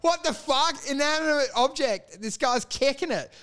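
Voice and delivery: high, baffled yelp of outrage; mock startled outrage. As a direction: wake up shocked and indignant. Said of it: "you'd wake up and go".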